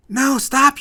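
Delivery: high loud desperate shout; yell. Acted. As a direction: scream at them to stop. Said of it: "yells Letty".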